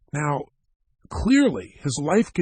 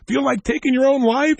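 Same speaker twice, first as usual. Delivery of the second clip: playful challenge; 9 semitones higher, 6 dB louder than usual. Acted. Teasing Bob before the audience.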